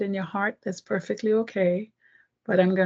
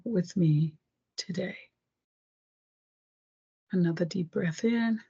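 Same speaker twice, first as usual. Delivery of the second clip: guided meditation. Acted. Meditation affirmation and breathing.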